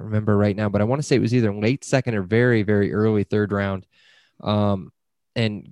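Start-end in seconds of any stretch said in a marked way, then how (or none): none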